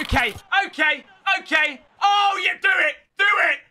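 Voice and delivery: exasperated. Real, not acted. Exasperated at the opponent.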